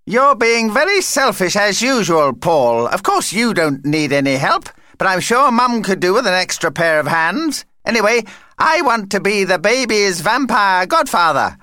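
high, self-important scolding; huffy vampire. Acted. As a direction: lecture in a high, insistent character voice. Vampire Vlad scolds, then insists.

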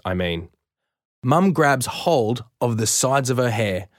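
ordinary delivery

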